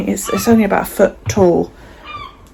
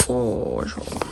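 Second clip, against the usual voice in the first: normal talking voice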